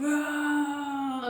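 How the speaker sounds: a high, raw mock crowd roar. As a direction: throw out a short yell like a rowdy schoolyard crowd. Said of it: "everyone's shouting".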